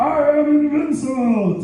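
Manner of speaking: high triumphant boast; triumphant cry retold. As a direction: crow it again as a callback. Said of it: "yeah, I".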